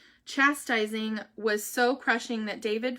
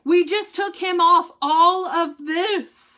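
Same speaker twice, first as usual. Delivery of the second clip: slow, higher, louder imitated nurse; thrilled nurse; 7 semitones higher, 10 dB louder, slower than usual. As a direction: announce the good news proudly. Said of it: "She goes".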